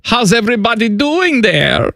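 high comic German greeting; Common Man's German accent. Pretend. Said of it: "Common Man's German accent".

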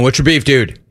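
ordinary talk, nothing marked

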